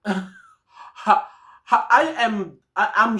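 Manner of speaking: short disbelieving laugh breaking into the words. Real, not acted. Vlogger laughing in disbelief at police news, laughing. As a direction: laugh it off in shock, pitch jumping up.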